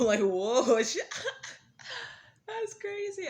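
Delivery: high, loud, laughing. Real, not acted. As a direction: squeal it through laughter. Laughing at grandma's remark.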